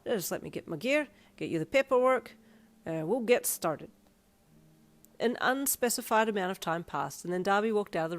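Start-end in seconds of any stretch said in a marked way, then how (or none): 0.1-3.9 brisk-minded Irish shopman, getting organised; friendly Irish piercer. Acted. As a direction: announce the next step in an Irish brogue.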